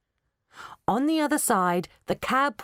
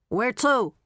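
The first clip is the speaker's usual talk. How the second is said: put-on cab rank attendant with a thick Brooklyn accent; 4 semitones higher than usual. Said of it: "with a thick Brooklyn accent".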